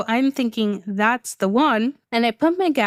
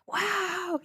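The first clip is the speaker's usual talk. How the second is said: soft, high, acted-out amazement; a hushed wow, retold in wonder; awed wow; 7 semitones higher, 10 dB quieter than usual. Guest seeing her suite, retold soft awe.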